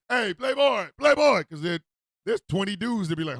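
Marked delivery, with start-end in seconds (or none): 0.1-1.4 loud, higher-pitched street holler; demonstrated yell. Shown as a demonstration. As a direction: yell it out to grab someone's attention. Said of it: "You can't just yell Playboy".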